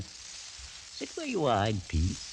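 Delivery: ordinary talk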